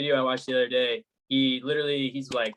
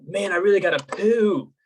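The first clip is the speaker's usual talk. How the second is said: high, loud, drawn-out mock urgency; retold urgency; 8 semitones higher, 5 dB louder, slower than usual. Friend retelling a YouTuber's first coffee, mock urgency. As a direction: play up the coffee-jittery guy, higher and slower. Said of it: "he's like".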